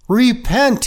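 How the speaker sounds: a fervent prophet's Repent; prophet's call. Acted. Voices the prophet's cry.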